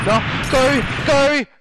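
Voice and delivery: high panicked yells; frantic shouting. Real, not acted. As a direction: scream at the game. Yelling at game.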